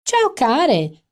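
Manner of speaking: high, loud trill across the bar; trilled greeting. A narrator's reading. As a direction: sing it out to be heard. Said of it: "trillò dal fondo del bar".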